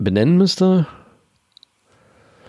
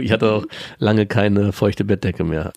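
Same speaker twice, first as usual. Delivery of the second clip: normal talking voice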